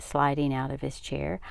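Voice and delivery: usual speaking voice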